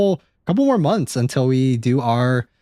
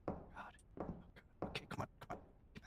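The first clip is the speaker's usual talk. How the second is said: whisper; 31 dB quieter, much faster than usual. Real, not acted. Hiding, urging quietly.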